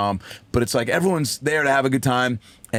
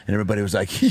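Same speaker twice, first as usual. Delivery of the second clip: ordinary delivery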